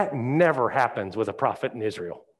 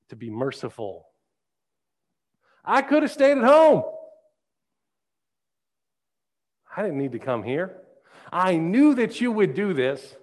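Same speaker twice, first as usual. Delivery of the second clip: petulant prophet griping at God; whiny Jonah; 6 semitones higher than usual. Acted. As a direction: whine like a sulking prophet.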